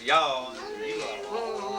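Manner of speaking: y'all and you all drawn out long and high; stretched out. Shown as a demonstration. Friend showing the Southern "you all", stretched out. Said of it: "Stretch it out a little bit".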